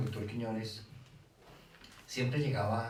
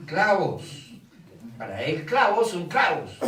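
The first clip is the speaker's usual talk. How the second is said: "Clavos" punched much higher and louder, slow; stress on clavos; 7 semitones higher, 10 dB louder, slower than usual. Shown as a demonstration. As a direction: hammer the word "clavos". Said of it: "énfasis en clavos". ("clavos" enfatizado, alto y fuerte)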